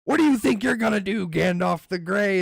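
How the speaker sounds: a lightly voiced taunting Goblin King. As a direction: sneer it at the wizard. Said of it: "And he's like".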